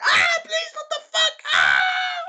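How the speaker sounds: shrill, very high, loud scream; agonized screaming. Retold or imitated. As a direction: beg and shriek as the balls hit. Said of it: "And then he's like".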